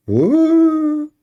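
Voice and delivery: high squeaked what; mock surprise. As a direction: squeal it in fake shock. Mock shock in parody.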